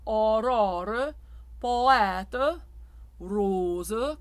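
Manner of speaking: aurore and poète with an open back jaw; open and closed O. Shown as a demonstration. Coach contrasting jaw openness for singers. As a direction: rose with a closed O. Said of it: "The back jaw".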